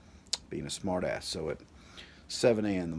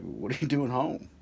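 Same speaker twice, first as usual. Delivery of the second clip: suspicious dad; 6 semitones higher, 4 dB louder than usual. Retold or imitated. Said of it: "My dad's like".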